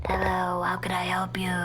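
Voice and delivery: low unvoiced whisper. Customer answers the pizza guy in a whisper. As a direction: breathe it out in a hushed voice. In-game character line whispered.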